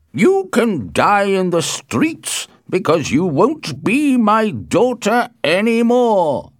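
loud, slow, high-pitched tirade; furious father, loudly hammering out threats; angry shouting. A narrator's reading. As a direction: rage as a father disowning his daughter. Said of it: "shouted Capulet angrily".

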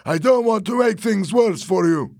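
high, loud reasoning; heated warning; agitated insistence. A narrator's reading. Fernando argues at gunpoint.

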